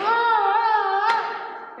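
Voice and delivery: high, loud mock sobbing cries, trailing to breath; imitated sobbing wail. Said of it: "and I'm like".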